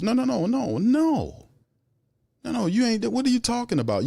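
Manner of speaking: can't believe what he's hearing; incredulous high-pitched protest; exasperated disbelief. Real, not acted. Rejecting the team's excuse.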